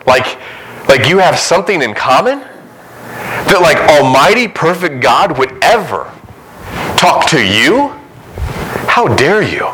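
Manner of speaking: high, slow scoffing disbelief; mock the nerve of it, stretched out high; incredulous mock outrage. Preacher mocking the listener's nerve, high incredulous scoff. Mocking the idea of calling God Father.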